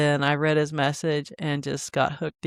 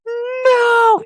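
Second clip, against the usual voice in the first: anguished cry; 5 dB louder than usual. Retold or imitated. Retold reaction to paywall.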